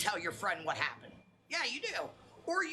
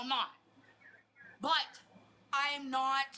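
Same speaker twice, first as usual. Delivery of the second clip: ordinary talk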